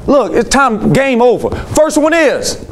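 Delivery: sharp call, pitched high; mad student shout. Retold or imitated. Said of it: "Then he got mad".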